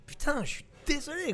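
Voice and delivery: high, strained sorry; exaggerated apology. Comedian turning down the role to his agent, high and apologetic. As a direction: wince through the apology.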